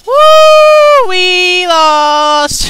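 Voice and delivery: loud high cheer. Real, not acted. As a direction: celebrate wildly. Cheering a loss.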